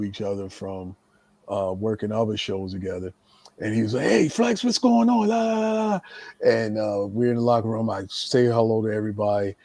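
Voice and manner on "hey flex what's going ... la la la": high-pitched exuberant greeting; Tom Brandy. Retold or imitated. Wrestler greeting a friend in the locker room, high and exuberant. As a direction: call out a hearty hello, pitched way up.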